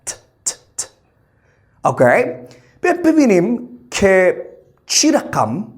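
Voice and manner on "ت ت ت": pure T, a short voiceless tap of sound as "ت"; true T sound. Shown as a demonstration. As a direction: isolate "ت" as a crisp, quiet T burst.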